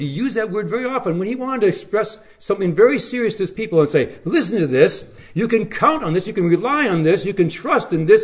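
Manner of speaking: high, loud impassioned sermon climax; full-force pulpit preaching; fervent preaching. Acted. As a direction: preach it out, rising and ringing.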